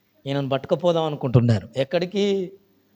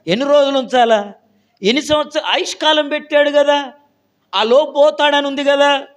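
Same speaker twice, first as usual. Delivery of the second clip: loud, high, driving preacher's challenge; preaching at full force; 6 semitones higher, 6 dB louder than usual. Acted. Preacher challenging the congregation, loud and high. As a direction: hammer the questions at the pews.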